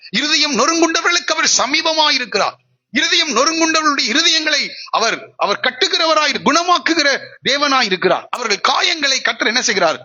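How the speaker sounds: high, impassioned preacher's delivery; fervent preaching. Real, not acted. Preacher assuring the congregation that God is near, fervent high delivery. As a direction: lift the promise high, phrase by phrase. Preacher's voice rises through the promise.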